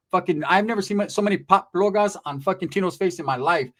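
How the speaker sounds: ordinary talk, nothing marked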